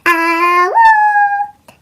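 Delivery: loud high rising howl; howling the last words of the song; dog howl. A narrator's reading. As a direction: throw back your head and howl. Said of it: "she howled".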